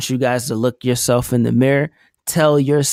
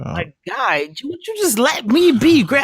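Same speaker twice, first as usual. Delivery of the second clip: mock voice; 12 semitones higher than usual. Teasing cohost mock voice.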